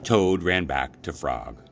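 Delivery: plain reading voice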